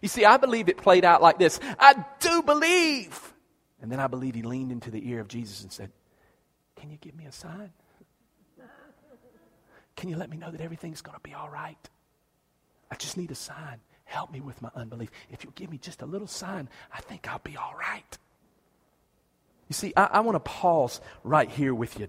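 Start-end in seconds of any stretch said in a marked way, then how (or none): 1.8-3.3 high, slow, loud declaration; drawn-out cry of belief; earnest father's vow. Acted. Father vowing belief to Jesus, high and slow. 6.8-18.2 hushed, breathy whisper; whispered plea. Acted. Father whispering a plea into Jesus' ear.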